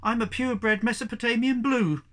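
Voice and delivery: high, loud blurted boast; proud boast. A narrator's reading. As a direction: puff up and announce it. Young cat blurting a proud claim.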